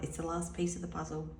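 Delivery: usual speaking voice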